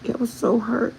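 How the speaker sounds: high, cracking hurt voice; tearful high cry. Retold or imitated. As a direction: say it like you're about to break down. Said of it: "And I'm like".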